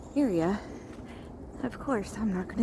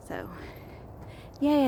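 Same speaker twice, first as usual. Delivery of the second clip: ordinary delivery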